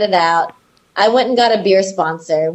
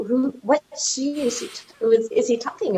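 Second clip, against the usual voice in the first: confused mutter; 4 semitones higher, 6 dB quieter than usual. Retold or imitated. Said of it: "and was like".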